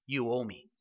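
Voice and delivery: low, soft quoted demand; understated demand. Retold or imitated. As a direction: voice the son's claim quietly, low and held back. Older son's demand, first take.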